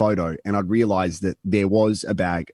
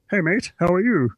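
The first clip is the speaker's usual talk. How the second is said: higher, chirpy greeting; vet voice; 10 semitones higher than usual. Retold or imitated. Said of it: "the vet's gone".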